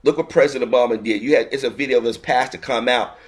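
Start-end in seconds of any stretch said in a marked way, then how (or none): none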